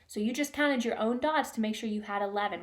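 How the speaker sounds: usual speaking voice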